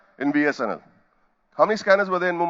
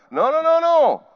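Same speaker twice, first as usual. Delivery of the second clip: emphatic refusal; 12 semitones higher, 6 dB louder than usual. Real, not acted. Laughing, rejecting low pay.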